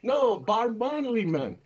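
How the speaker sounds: a hint of a Jamaican accent, patient and insistent; Jamaican beach hustler. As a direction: lightly imitate a Jamaican hustler pushing his offer. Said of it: "I said".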